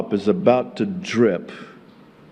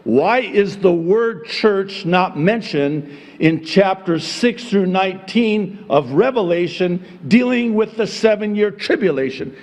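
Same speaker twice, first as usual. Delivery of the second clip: ordinary talk, nothing marked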